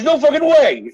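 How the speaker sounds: high, mocking "no way"; sarcastic friend calling his bluff; sarcastic disbelief. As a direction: scoff at it like you don't buy it. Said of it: "so sarcastic about it".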